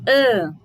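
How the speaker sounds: loud nasal ã, held. Shown as a demonstration. Teacher modelling the nasal vowel in ambos. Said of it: "como se tivesse o acento aqui".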